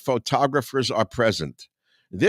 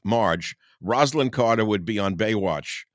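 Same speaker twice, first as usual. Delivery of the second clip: normal talking voice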